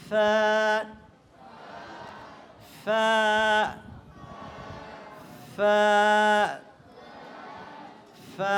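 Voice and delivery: teacher's clean f in "ফা"; letter fa. Shown as a demonstration. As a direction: model "ফা" as an f, not a two-lip puff. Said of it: "এইভাবে ফা উচ্চারণ করবো".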